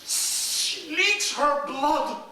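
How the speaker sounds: crying out. A narrator's reading. Narrator wails the wound.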